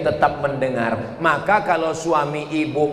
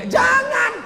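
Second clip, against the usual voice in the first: very high shout cutting in; a sudden high cry of no; sharp stop. Real, not acted. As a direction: stop the prayer with a sudden yelp.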